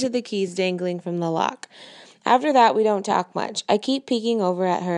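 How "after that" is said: ordinary delivery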